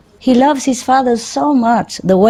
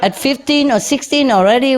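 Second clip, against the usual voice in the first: laughing. Real, not acted. Laughing at her teenage shyness.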